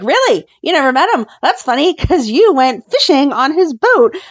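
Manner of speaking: springing the trap on him with sarcasm; sarcastic confrontation. Retold or imitated. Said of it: "She was like".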